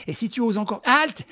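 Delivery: high playful shout halte; sudden mock command; mock threat.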